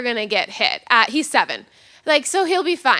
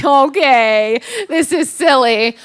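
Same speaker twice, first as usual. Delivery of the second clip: slow, louder mock agreement; dismissive, dragged-out mockery; 5 dB louder, much slower than usual. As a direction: draw it out to humour them. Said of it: "And I'm mocking this".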